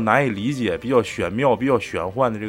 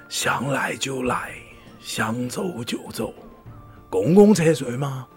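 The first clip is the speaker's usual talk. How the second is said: security captain quote; slower than usual. Retold or imitated. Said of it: "保安队长说的一句话".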